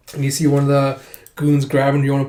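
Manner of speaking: ordinary delivery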